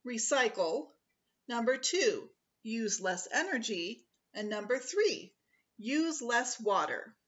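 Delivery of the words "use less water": usual speaking voice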